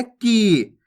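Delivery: "की" pulled out long and slow; drawn-out vowel. Shown as a demonstration. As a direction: take time on the ee of "की". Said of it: "खींच के पढ़ेंगे".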